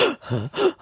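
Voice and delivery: rasping gasp for air, imitated; gasping like a dying man; imitated dying breath. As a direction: act out someone's last breaths. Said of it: "how he was breathing".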